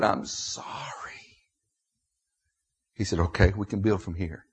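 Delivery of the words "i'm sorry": hushed, breath-only apology; retold whispered confession. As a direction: whisper it in penitence.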